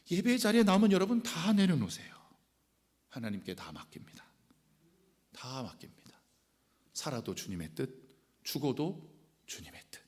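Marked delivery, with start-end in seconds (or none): 3.1-10.0 prayerful whisper. Real, not acted. Surrendering all to God.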